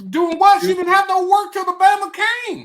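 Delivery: loud, high comic outrage; incredulous. Real, not acted. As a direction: scoff at the excuse at full volume. Mocking the wife's excuse of work.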